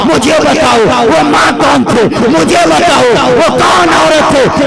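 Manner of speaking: high, loud impassioned sermon climax; fiery preaching. Acted. Preacher revealing the story's hero to the crowd, shouted. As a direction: thunder the revelation to the hall.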